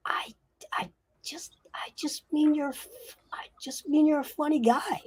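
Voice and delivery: high, whispery stammer; squeaky, halting, intimidated little imp; frightened imp. Acted. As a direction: stammer in a tiny, scared whisper. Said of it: "The imp says".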